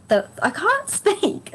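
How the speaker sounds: real laugh cracking the sentence; laughing at own voice. Real, not acted. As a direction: giggle through the words as the voice gives out. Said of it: "I need to work on my throat chakra".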